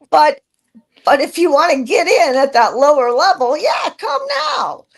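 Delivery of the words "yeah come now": excited call. Real, not acted. Urging people to join now.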